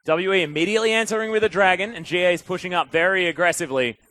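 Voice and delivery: high, loud esports shoutcast; excited play-by-play. Real, not acted. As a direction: call the kill at full force, voice lifted. Live play-by-play at full pitch.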